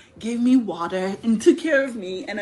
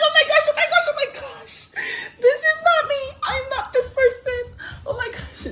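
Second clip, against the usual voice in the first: much higher, laughing and half crying; mortified laugh-cry; 5 dB louder than usual. Retold or imitated. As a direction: squeal through laughter in embarrassment. Mortified at the cleaned-up vomit.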